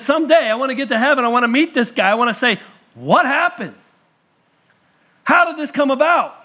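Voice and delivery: high, quick and eager, mock-excited; eager retold thief. Thief longing to meet Jesus.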